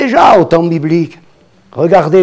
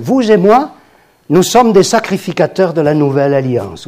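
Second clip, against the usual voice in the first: normal talking voice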